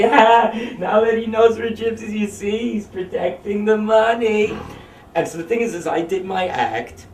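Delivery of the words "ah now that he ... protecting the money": mock parent voice. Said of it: "they were like".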